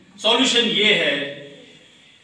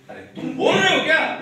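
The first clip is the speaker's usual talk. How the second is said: raised, scolding voice of a strict father; mock scolding parent; 7 semitones higher, 3 dB louder than usual. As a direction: snap at the child like a bossy parent. Parent scolding child acted out. (डांटते हुए पिता की नकल)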